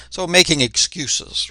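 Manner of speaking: ordinary delivery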